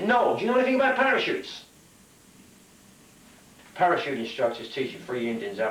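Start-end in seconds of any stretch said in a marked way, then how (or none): 0.0-1.6 high mock yell while plummeting; raised, high-pitched comic shout; calling back. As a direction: holler it back in comic panic. Falling parachutist calls back.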